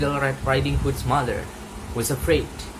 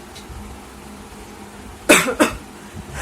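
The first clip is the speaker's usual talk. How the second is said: muffled cough; 11 semitones higher than usual. Acted. Grandma stuck in cupboard.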